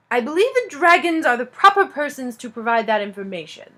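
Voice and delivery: stiff, self-important elf voice; pompous. Acted. Elf steward answering the king, pompous. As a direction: lay on the dignity, word by word. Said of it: "sounding more and more pompous".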